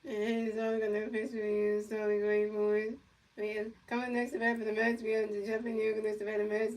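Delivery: play-by-play announcer. Pretend. Kid calling a pretend Mets game, announcer style. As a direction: pretend baseball commentator calling the pitches.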